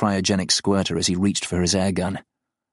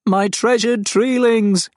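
high, loud, slow alien cry; grieving alien wail; anguished creature; 5 dB louder, slower than usual. Acted. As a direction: mourn the treelings like a hurt beast. The farm mourns its trees.

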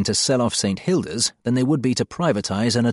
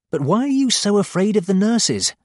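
surprised; 10 semitones higher, 3 dB louder than usual. Acted. Puzzled question to inmates.

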